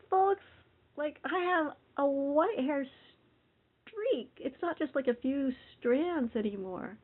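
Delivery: high, loud and amazed; shocked. Real, not acted. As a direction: squeal out real astonishment.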